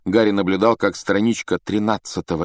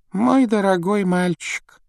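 gentle; 11 semitones higher, slower than usual. A narrator's reading. Headmaster addresses his dear boy, gently. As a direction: say it tenderly, drawn out. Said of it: "с мягкостью в голосе заговорил Диппет".

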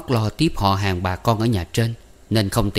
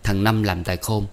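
plain reading voice

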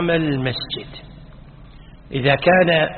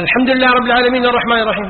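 fast, higher recitation; imam reciting; 6 semitones higher, faster than usual. Shown as a demonstration. Teacher voicing an imam who rushes the Fatiha, fast. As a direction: race through the verses. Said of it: "قال".

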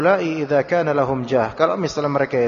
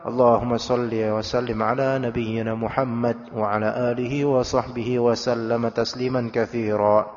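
calm, even recitation of the salawat; plain recited salawat; 5 semitones lower, 4 dB quieter than usual. Shown as a demonstration. Teacher showing normal-tone salawat to students, low and even. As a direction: recite it as in prayer, low and steady. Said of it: "Misalnya antum salat".

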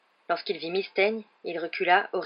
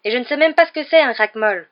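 high, loud indignant denial; emphatic wronged-pupil protest; vigorous protest; 6 semitones higher, 11 dB louder than usual. A narrator's reading. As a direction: insist loudly that you're innocent. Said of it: "protesta Harry avec vigueur".

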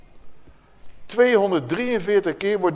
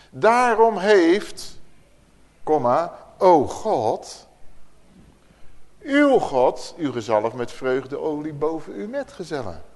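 solemn scripture reading; slower than usual. Acted. Preacher reading Psalm 45 aloud to the group, solemn declaiming. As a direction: declaim the verse, pausing on each phrase.

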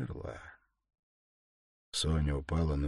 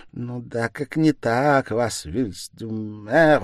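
slow, louder, mocking repeat; scornful mockery; 6 semitones higher, 10 dB louder, slower than usual. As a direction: drag the words out in contempt. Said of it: "Выдумает же болван".

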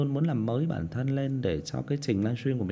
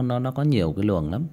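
ordinary talk, nothing marked

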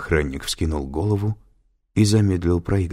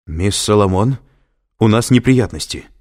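loud, high, urgent warning; worried raised voice; alarmed; 5 semitones higher, 7 dB louder than usual. A narrator's reading. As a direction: break the trouble to her with alarm. Alarmed guard reports trouble by phone.

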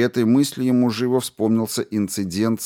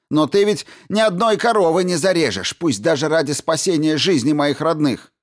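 high, loud bitter accusation; angry shouting; 8 semitones higher, 3 dB louder than usual. A narrator's reading. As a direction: rail at him furiously. Eddy accusing in quarrel.

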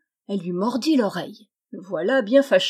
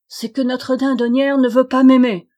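louder, higher sulky cry; wailing complaint; 4 semitones higher, 8 dB louder than usual. Acted. Rejected prince wails to his father, loud and high. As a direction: bawl out the grievance.

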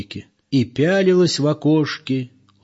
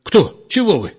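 higher, louder startled question; alarmed; 8 semitones higher, 4 dB louder than usual. Acted. Old man wakes up and asks in alarm, startled. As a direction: woken and worried. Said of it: "спросил встревоженно".

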